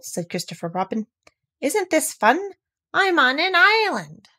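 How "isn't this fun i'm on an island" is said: put-on higher, delighted little boy; excited child. A narrator's reading. Christopher Robin calling to Owl, high and delighted. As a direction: bubble over with glee.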